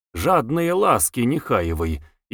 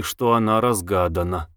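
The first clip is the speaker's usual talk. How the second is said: usual speaking voice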